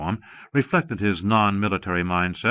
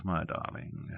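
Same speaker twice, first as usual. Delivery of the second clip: hushed endearment; 4 semitones lower, 13 dB quieter than usual. Acted. Fury softens to Hydra.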